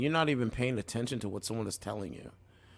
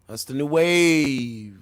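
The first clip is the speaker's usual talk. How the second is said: loud drawn-out proclamation; 4 semitones higher, 11 dB louder, much slower than usual. Real, not acted. Streamer announcing the new wave to chat, loud and slow. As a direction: boom it out, slow and stretched.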